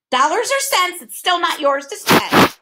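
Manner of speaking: high, loud, haughty bird; haughty bird squawk. A narrator's reading. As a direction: screech it with disdain. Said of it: "the bird replied haughtily".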